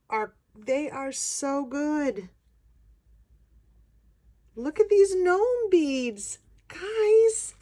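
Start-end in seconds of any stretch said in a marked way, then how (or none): 4.6-7.5 high and loud, bursting with excitement; excited squeal. Real, not acted. Showing the gnome beads to viewers, high loud excited call.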